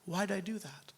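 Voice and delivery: hushed self-reproach; regretful whisper. Retold or imitated. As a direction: whisper it to yourself, full of regret. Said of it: "and then I'm like".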